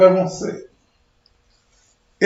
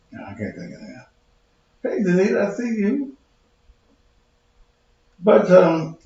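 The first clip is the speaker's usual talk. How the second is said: usual speaking voice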